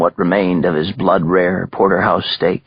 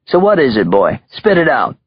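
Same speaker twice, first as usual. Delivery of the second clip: higher Brooklyn gangster, pushing; impatient mobster; 5 semitones higher than usual. Acted. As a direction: demand it like a bored boss. Mobster demanding the message.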